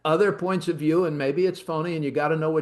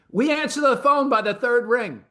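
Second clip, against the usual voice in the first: louder, higher sales pitch; proud boast; 8 semitones higher, 4 dB louder than usual. Real, not acted. Host plugging his firm to listeners, proud pitch.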